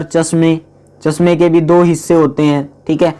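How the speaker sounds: ordinary delivery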